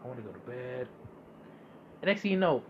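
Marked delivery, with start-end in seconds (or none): 0.0-0.9 sleepy groan. Retold or imitated. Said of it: "I'm like".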